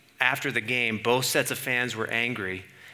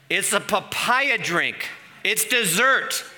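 slow, higher-pitched mock outrage; indignant protest; 5 semitones higher, slower than usual. Diner retelling a complaint about a garlicky drink, mock indignant. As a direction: protest each word slowly, in disbelief.

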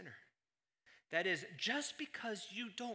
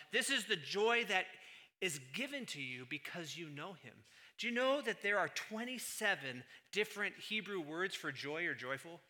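usual speaking voice

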